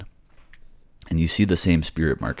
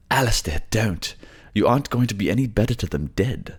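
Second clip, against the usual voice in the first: raised, higher voice, quick and urgent; pleading companion; 7 semitones higher than usual. Acted. Companion calls out to Alistair to stop, urgent plea. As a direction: beg him to stop before it's too late.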